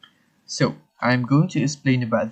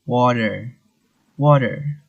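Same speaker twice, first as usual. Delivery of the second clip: US accent. Shown as a demonstration. Teacher showing the US water in contrast, American accent. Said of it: "But for the U S".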